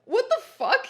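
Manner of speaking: high, loud mock outrage; angry. A narrator's reading. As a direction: explode in an overacted, high-pitched protest. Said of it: "he asked angrily".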